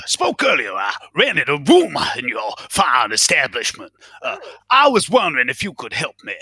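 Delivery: ordinary talk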